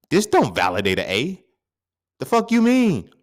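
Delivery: loud, high, incredulous snap; indignant retort. Acted. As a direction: throw it back in disbelief.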